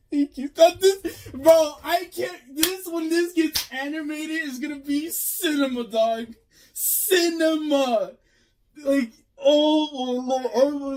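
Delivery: high, strained, drawn-out exclaims; gushing and slowing on each word; emotional excitement. Real, not acted. Fan gushing at the page, high emotional exclaims. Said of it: "I'm getting so emotional right now".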